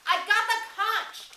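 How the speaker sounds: very high, loud and slow; shout. Acted. Piggy shouting to the booing tribe, holding the conch. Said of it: "he shouted".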